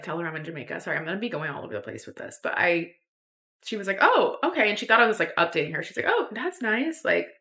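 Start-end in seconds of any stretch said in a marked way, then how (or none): 4.0-4.7 high-pitched, casual mock-mom reply; unconcerned mom. Mom thinking it's just an update, unconcerned. 6.1-7.0 high, breezy imitation of a pleased mom; oblivious mom. Mom hearing a travel update, oblivious.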